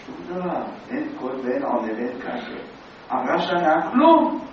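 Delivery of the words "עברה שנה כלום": a year passed, and then a sharp exclaimed כלום; dramatic nothing. Acted. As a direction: build to an exclamation. Dramatic payoff, nothing happened.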